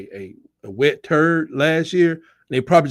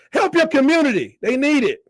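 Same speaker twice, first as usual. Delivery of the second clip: mock preachy; 10 semitones higher than usual.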